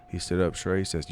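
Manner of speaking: ordinary talk, nothing marked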